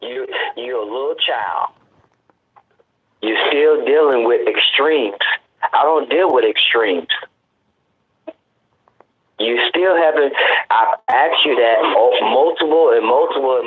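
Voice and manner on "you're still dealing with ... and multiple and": heated, louder, hammering the point home; scolding the caller who dodges his question; angry raised voice. Real, not acted. Host loses patience with the caller and scolds him loudly.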